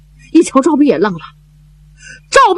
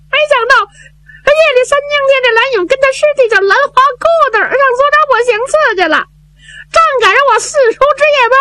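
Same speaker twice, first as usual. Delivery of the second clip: high, hurried, tearful telling; tearful young man; much faster than usual. Acted. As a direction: rush through the bad news, choked up. Zhao Bi tells the news. (赵弼带着哭腔高声急说噩耗)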